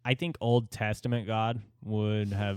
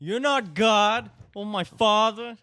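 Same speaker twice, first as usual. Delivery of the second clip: higher, louder, slow outraged parody of the ranting man; mock angry short man; 9 semitones higher, 6 dB louder than usual. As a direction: bellow his line like the furious customer. Mocking the short man's rant.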